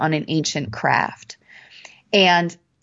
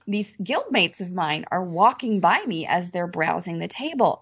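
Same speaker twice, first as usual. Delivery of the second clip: ordinary talk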